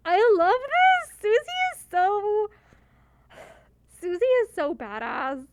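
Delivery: thrilled and gushing, slow; excited gushing. Real, not acted. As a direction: gush with delight. Delighted by Susie's line.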